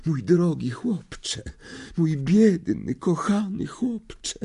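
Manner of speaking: high, slow, trembling seer voice; theatrical seer wailing over the boy; anguished seer. A narrator's reading. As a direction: clutch your heart and refuse to tell. Trelawney overcome.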